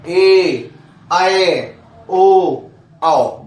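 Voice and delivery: ए, ऐ, ओ, औ each held long and loud; long vowels. Shown as a demonstration. Teacher reciting examples of long vowels, each drawn out. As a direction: sustain ए, ऐ, ओ, औ one after another. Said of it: "जिनके उच्चारण में अधिक समय लगे".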